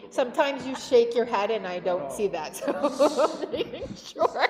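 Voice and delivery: amused laughing apology to a member. Real, not acted. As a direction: explain while giggling. Chair laughing while explaining.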